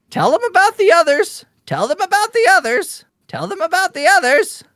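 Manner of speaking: very high, insistent bird voice; squawking raven. Acted. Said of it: "hear the raven squawk".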